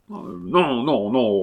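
violent refusal. A narrator's reading. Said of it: "la violence de son refus".

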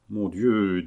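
usual speaking voice

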